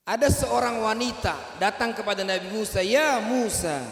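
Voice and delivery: loud, high storyteller call; loud dramatic narration. A narrator's reading. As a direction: raise the voice to summon Musa. Preacher calling out in story.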